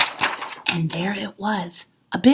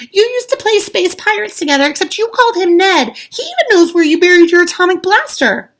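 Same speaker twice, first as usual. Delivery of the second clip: high, loud insistent explaining; child talking fast with excitement; eager child; 11 semitones higher, 9 dB louder than usual. A narrator's reading. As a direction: convince dad eagerly. Child pleads with father.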